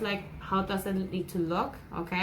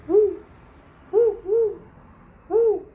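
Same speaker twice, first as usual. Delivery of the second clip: high loud hooting woo; owl hoot; 11 semitones higher, 8 dB louder than usual. Shown as a demonstration. Teacher hooting like an owl for the class. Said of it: "He can sound like an owl".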